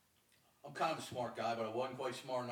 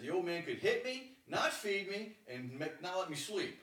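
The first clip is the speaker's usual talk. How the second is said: raised, forceful retelling, pitched up; emphatic retelling; 10 semitones higher, 8 dB louder, slower than usual. Retold or imitated. Telling his roommate what his father did, raised and forceful. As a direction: hammer the list home to his roommate.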